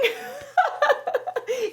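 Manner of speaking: high-pitched burst of laughter. Real, not acted. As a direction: let out a squealing laugh. Laughing at own story.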